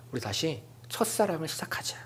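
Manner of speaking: soft whispered invitation; tender proposal. Retold or imitated. God proposing a fresh first love to his people, whispered tenderly.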